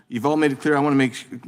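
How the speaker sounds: usual speaking voice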